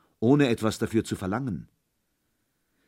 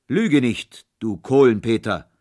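booming thunder voice; 2 semitones higher, 8 dB louder than usual. A narrator's reading. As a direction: roar it down at the boy. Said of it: "rief Holländer Michel mit donnernder Stimme". (donnernde Stimme)

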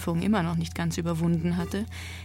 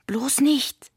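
higher, louder hissed snap; grumbling snap; 7 semitones higher, 6 dB louder than usual. A narrator's reading. Nota stops her brother, snapping louder. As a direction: snap at him to stop. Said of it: "raunzte Nota".

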